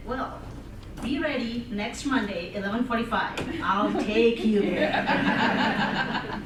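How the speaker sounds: ordinary talk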